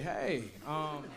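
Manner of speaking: soft, hesitant mock-nervous opener; nervous phone call. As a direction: play it mildly nervous and awkward. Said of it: "On the phone, nervous and shit".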